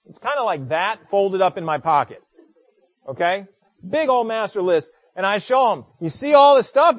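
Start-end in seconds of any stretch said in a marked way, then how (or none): 6.0-7.0 excited. Retold or imitated. Pastor excitedly showing stranger list.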